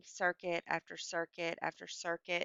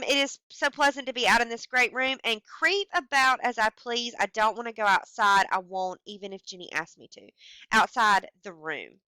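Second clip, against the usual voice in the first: the trapped wife's words read a little higher and louder; dramatic reading; 4 semitones higher, 9 dB louder than usual. Acted. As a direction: read her eager, half-mad longing to stay inside. Reads the woman's words aloud.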